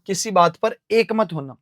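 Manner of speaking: ordinary talk, nothing marked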